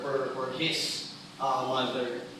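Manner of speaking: normal talking voice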